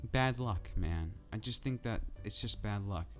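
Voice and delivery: normal talking voice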